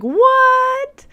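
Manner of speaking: sharp, high incredulous yelp; shocked what. Retold or imitated. Patient hearing her inner voices may be hallucinations, shocked high yelp. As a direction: blurt it out in sudden disbelief. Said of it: "'cause I'm like".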